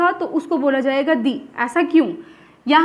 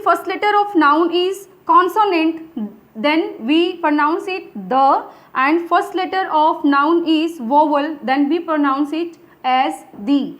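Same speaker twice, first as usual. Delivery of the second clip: slow dictation pace; much slower than usual. Shown as a demonstration. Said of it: "slow dictation of the rule".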